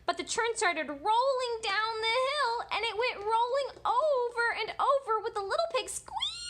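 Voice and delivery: high, building storyteller excitement; excited narration. A narrator's reading. Storyteller as the churn rolls downhill, high excited rush. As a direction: let it climb and tumble, pitched high. Churn tumbles with squealing pig.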